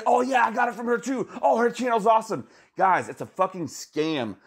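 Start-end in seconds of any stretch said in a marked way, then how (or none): 0.0-2.5 mock fake commenter. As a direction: squeal it like a bot account pretending to be thrilled.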